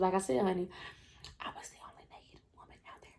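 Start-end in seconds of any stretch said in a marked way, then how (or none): 1.4-3.1 secret whisper. Acted. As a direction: lean in and whisper it. Whispered confession to viewers.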